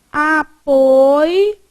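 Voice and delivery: "Apoio" as a, poi, o, loud and drawn out; split into syllables. Shown as a demonstration. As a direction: separate each syllable of "Apoio". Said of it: "eu já separei certo".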